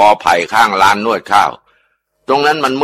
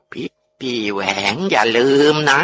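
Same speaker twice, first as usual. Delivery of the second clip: eerie ghost voice; 4 semitones higher than usual. Acted. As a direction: let a dead woman speak through the possessed aunt. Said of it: "เสียงที่ออกมาจากปากป้าจำปี มันแปลกๆ มันไม่ใช่เสียงป้าเองอะ".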